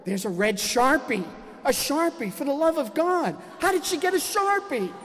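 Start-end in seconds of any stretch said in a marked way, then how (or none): 1.6-4.9 high, loud exasperated disbelief; hammed-up indignant dad, voice pitched way up; outraged rant. Father finds a Sharpie, rants in comic outrage.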